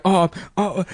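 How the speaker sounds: put-on hesitant young amateur on camera, full of ums; nervous kid host. Kid's homemade Jackass intro, acted.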